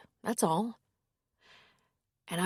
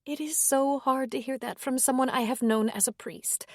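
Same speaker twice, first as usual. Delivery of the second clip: higher, hesitant woman's voice; 6 semitones higher than usual. A narrator's reading. Woman gently declining the priest's invitation, higher voice.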